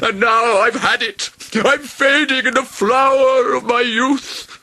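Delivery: high, loud, slow wailing dragon; yammering yell. A narrator's reading. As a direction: wail dramatically as if dying. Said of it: "a terrible yammering yell".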